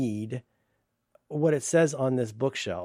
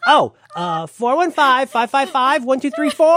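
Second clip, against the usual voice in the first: much higher, louder, slow sing-song readout; high, slow mock receptionist; 10 semitones higher, 8 dB louder, slower than usual. Mocking the receptionist reading out his number, high and slow. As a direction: read the number out like a cheerful clerk.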